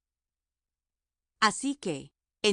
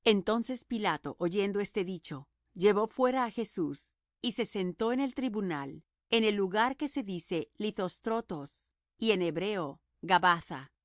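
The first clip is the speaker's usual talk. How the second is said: usual speaking voice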